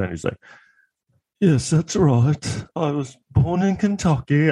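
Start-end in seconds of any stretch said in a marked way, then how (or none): 1.4-4.5 high, slow mock British accent; imitating Johnny Depp. Comic mocking Johnny Depp's British accent. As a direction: drawl it slowly in a hammed-up British accent. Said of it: "an affect in his voice".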